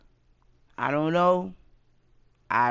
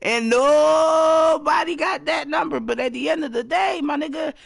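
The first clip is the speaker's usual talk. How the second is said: laughing shout; 8 semitones higher, 6 dB louder than usual. Real, not acted.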